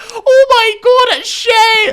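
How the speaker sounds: very high, full-volume drawn-out scream; imitating a friend's thrilled yell; excited shriek. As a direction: shriek it on spotting someone in a crowd. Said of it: "all I hear is Thomas going".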